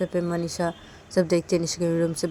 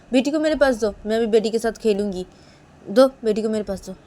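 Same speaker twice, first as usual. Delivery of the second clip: loud, higher-pitched demanding; insistent demand; 4 semitones higher, 8 dB louder than usual. Acted. Nisha demanding the baby to play with, insistently. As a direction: press the demand with raised voice.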